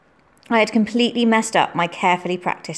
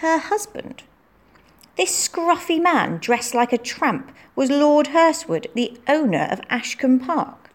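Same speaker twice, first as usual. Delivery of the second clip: astonished; 7 semitones higher, slower than usual. A narrator's reading. Anna's astonished inner thought.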